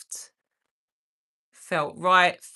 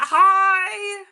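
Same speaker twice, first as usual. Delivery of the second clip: put-on much higher, tiny child's hi; little girl's hi; 12 semitones higher, 6 dB louder than usual. Voicing young viewer Ruby greeting the streamer, much higher. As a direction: chirp it like a small child. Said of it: "Ruby's like".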